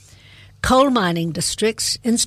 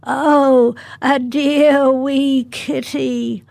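put-on high, slow creaky old lady voice; creaky croak; 5 semitones higher, 3 dB louder, much slower than usual. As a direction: croak it out like a frail old woman. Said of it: "A creaky, scrapey voice behind me croaked".

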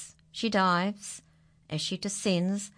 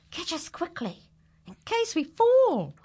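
much higher pleading voice; animals pleading; 11 semitones higher, 6 dB louder than usual. Acted. The stuck animals plead to be caught. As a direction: plead like a small scared animal.

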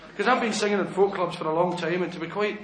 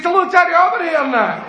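high, loud yelling down the phone; agitated Glasgow chap; 11 semitones higher, 9 dB louder than usual. Acted. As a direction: holler like a worked-up Glaswegian. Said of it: "There was a young Glasgow chap".

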